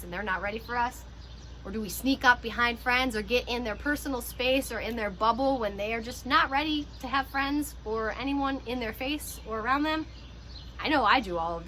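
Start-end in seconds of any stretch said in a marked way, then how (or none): none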